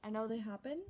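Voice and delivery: ordinary talk, nothing marked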